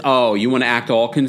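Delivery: usual speaking voice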